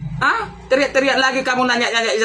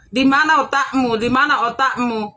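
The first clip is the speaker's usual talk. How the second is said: ordinary talk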